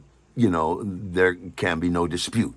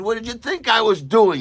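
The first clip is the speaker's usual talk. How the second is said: indignant; 14 semitones higher, 6 dB louder than usual. Retold or imitated. Indignant retort to bartender.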